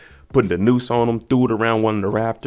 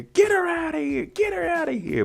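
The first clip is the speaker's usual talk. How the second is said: much higher, squeaky repeated order; frantic order. Retold or imitated. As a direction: squeal it like a panicked nurse. The nurse told to remove Lucky.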